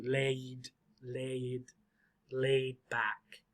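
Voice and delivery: low, soft LAID, the A gliding almost into two syllables; drawn-out long A. Shown as a demonstration. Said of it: "It almost sounds like two".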